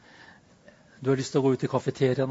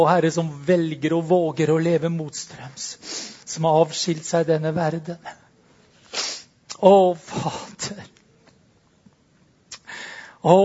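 fervent prayer; 3 semitones higher, 6 dB louder than usual. Real, not acted. A pastor praying over the congregation, fervent. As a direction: pour it out to God, building.

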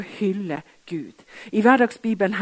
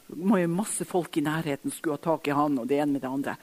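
usual speaking voice

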